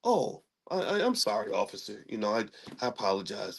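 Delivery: soft, low, halting mock apology; suddenly calm. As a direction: imitate a man abruptly calmed, apologizing meekly. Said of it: "He said he just snapped out of it".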